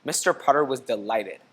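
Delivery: plain reading voice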